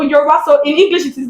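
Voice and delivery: normal talking voice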